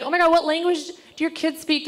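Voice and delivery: mock-excited, high-pitched nosy asker; gushing question. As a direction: imitate someone gushing with curiosity. Acting nosy people asking about kids.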